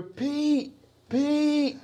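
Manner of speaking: high, calling across a street; imitating a boy calling a name from afar. Boy calls to Pete from across the street, calling out.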